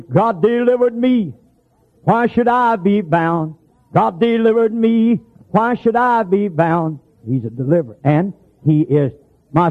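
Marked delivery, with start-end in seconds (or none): 0.0-7.0 slow, high, drawn-out declamation; down-home recitation. Retold or imitated. Preacher quoting folks down home, slow high recitation. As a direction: stretch each phrase out, pitched high. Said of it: "color folks down home say".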